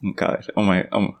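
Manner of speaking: ordinary talk, nothing marked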